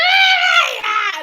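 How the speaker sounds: very high, loud mock wail; hammed-up freak-out; mock panicked scream. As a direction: shriek in comic panic, imitating a frantic mother. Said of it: "She was all panicking".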